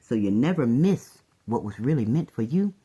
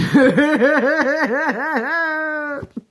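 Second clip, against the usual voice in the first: high cackling laugh; 13 semitones higher, 6 dB louder than usual. Real, not acted. Laughing at them.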